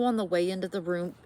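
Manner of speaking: usual speaking voice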